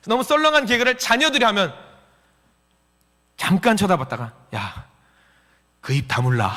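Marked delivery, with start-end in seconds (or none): 4.6-6.5 deadpan retort. Acted. Child to father after a lame joke, deadpan retort. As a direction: let it drop low after a stunned pause.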